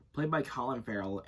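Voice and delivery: ordinary delivery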